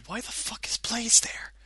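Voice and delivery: whispered mock question. Fans at Sonic's party whispering about Blaze, unvoiced whisper. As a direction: hiss it out under your breath in mock confusion.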